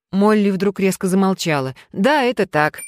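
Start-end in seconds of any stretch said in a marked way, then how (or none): none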